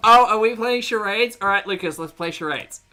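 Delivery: high, loud playful call; playful excitement. Real, not acted. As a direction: tease them brightly. Playful reaction.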